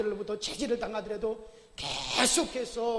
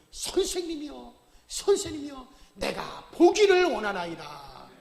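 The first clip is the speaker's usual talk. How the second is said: slow, earnest plea, rising to loud; pleading; 3 semitones higher, 7 dB louder than usual. Acted. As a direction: beg the teacher for sight. Blind man begs Jesus.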